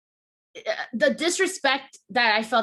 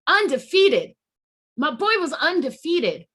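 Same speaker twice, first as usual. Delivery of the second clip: "undefeated" punched high and loud; high, emphatic protest on "undefeated"; indignant emphasis; 5 semitones higher, 4 dB louder, slower than usual. Real, not acted. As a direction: hammer the word "undefeated" in outrage. Indignant defence of favourite wrestler.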